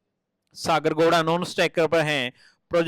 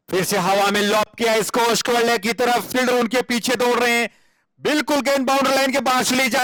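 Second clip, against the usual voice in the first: rising, excited boundary call; excited cricket commentary; 7 semitones higher, 3 dB louder than usual. Acted. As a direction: build the call to the rope.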